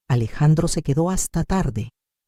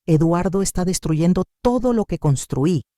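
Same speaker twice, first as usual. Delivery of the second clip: plain reading voice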